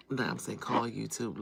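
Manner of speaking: normal talking voice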